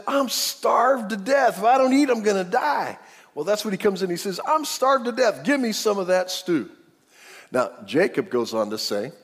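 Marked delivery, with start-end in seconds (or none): 0.1-3.0 hammed-up starving. Preacher imitating anyone who skipped meals, hammed-up starving. Acts out exaggerated hunger complaint. 4.5-6.7 high mock-desperate complaint; hammed-up hungry demand. Hunter back empty-handed demanding stew, hammed-up hungry demand.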